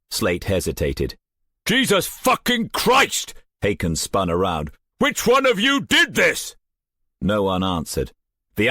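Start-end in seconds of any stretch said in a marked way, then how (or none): 1.6-3.3 angry outburst. A narrator's reading. Commander explodes at a death. 5.0-6.5 much higher, louder furious demand; angry shout. A narrator's reading. Commander demands who killed her.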